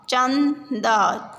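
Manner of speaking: चंदा drawn out very slowly, syllable by syllable; slow syllable reading. Shown as a demonstration. Teacher sounds out चंदा for the class, very slowly. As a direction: stretch out चंदा so children hear each part. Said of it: "शब्दों को पढ़ेंगे".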